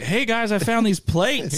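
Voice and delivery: high, drawn-out mock announcement; mock excitement. Mocking Joseph Smith's excited announcement.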